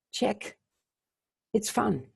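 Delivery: ordinary talk, nothing marked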